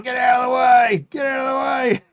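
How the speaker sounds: high shout, imitating a mate; imitating a mate shouting at the crowd to move; mate yelling. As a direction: yell it to clear the way. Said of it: "you hear me mate going".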